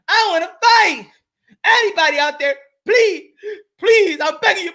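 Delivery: high, pleading mock voice, begging anyone to fight him; hammed-up whiny plea, please please. Imitating Gary begging for fights.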